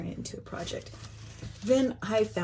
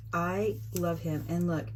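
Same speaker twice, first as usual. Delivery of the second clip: ordinary talk, nothing marked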